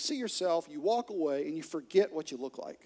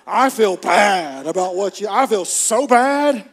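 loud mock confession of guilt; loud, drawn-out, exaggerated remorse; hammed-up guilt; 12 dB louder, slower than usual. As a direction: belt it out like an overwrought churchgoer. Churchgoer proclaims his guilt, exaggerated.

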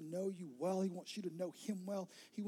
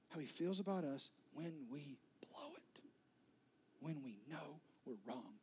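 hushed whisper; 3 semitones lower, 7 dB quieter than usual. Acted.

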